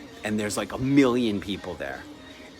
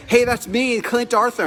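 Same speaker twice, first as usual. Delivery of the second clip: eager, higher and louder; excited; 11 semitones higher, 5 dB louder than usual. Retold or imitated. Spotting his name on the envelope, excitedly calling out higher and louder. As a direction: call out eagerly to claim the envelope. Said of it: "And I go".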